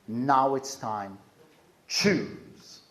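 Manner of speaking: slow, weighty command like a hammer; measured, authoritative divine voice; commanding father voice. Retold or imitated. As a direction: speak with a father's unjoking authority. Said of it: "like a voice of a father ... With such authority, like a hammer".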